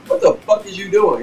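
high, startled what-are-you-doing; shocked question. Retold or imitated. Teen shocked at a friend dipping a cigarette, startled high question. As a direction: jump up in pitch, caught off guard. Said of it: "I'm like".

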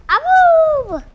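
high, loud howling yell. Real, not acted. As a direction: howl it out like a wolf at full volume. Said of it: "Please don't yell into your mic".

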